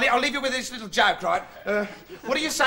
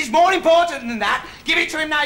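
loud, high-pitched pressing demand; urgent insistence; 7 semitones higher, 6 dB louder than usual. Acted. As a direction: insist it cannot wait. Bloke insists urgently in acted argument.

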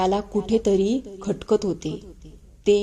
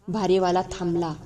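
usual speaking voice

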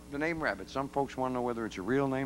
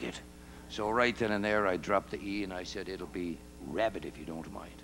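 Irish brogue; 4 semitones lower than usual. Retold or imitated. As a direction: lower thick Irish brogue. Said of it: "with this thick Irish brogue".

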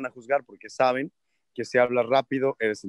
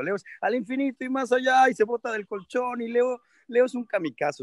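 much higher, thrilled; excited; 9 semitones higher than usual. Real, not acted. Father describing his wild son, excited. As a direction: gush about the wild son.

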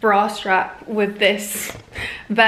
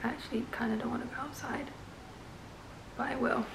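quiet aside; 18 dB quieter, faster than usual. Real, not acted. Reluctant aside about rain.

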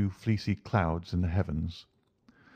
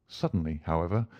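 usual speaking voice

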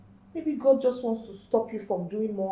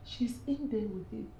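usual speaking voice

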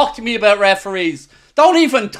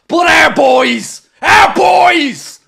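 emotional shout; 4 semitones higher, 4 dB louder, slower than usual. Real, not acted. Tribute to the winning players.

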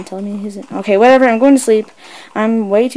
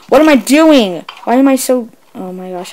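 usual speaking voice

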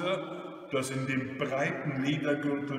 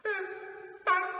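put-on very high, slow old lady; timid, high-pitched lisped echo; lisping grandmother; slower than usual. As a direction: lisp it back meekly. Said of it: "lispelte die Großmutter".

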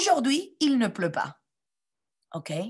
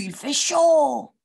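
exaggerated heat; 6 semitones higher, 5 dB louder, slower than usual. Taoki sweating in heat.

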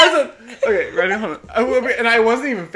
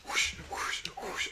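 whispered, airy whoosh sounds; whooshing noises; 15 dB quieter, slower than usual. Retold or imitated. Telling how everything spun around her, hushed whooshing. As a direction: breathe out the swirl of a spinning room.

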